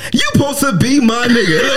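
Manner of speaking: high, laughing mock hurt; laughing protest. Friend protesting his buddy's betrayal, laughing indignation. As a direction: plead through the laughter. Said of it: "I'm like".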